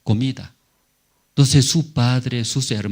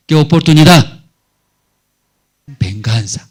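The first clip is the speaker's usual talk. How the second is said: vengeful gloating; 4 semitones higher, 10 dB louder than usual. Retold or imitated. Joseph tempted by revenge.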